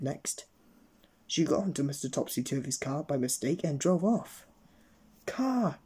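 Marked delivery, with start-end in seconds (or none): 5.2-5.8 high shout of car. A narrator's reading. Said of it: "he shouted".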